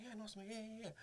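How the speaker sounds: high, soft, eager-to-please voice; mock meek agreeing. Actor replying to a welcome, mock meek agreeing. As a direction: agree meekly in a small high voice.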